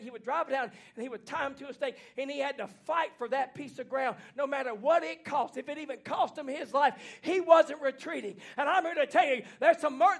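normal talking voice

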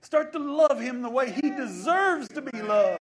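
loud, high preacher's climax, held slow; fervent preaching shout. Real, not acted. Pastor exhorting his flock to give thanks, fervent shout. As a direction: cry it out to the congregation.

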